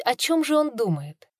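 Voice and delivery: ordinary delivery, nothing marked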